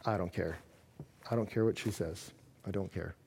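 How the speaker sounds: low, very soft, detached; cold disdain. Acted. One partner dismissing the other, cold and withdrawn. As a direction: drop the voice and shut them out. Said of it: "when one partner is cold".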